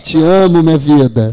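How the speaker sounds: loud, quick and beaming; loving declaration. Real, not acted. Coach proclaims love for his fiancée on air, loud. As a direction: proclaim her as the love of your life.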